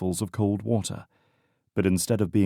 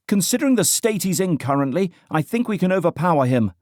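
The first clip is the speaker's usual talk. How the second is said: higher voiced woman, brisk and sure; confident young woman; 10 semitones higher, 5 dB louder, faster than usual. A narrator's reading. Young woman reassuring the group about the stranger. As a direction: she dismisses the danger. Female character speaking.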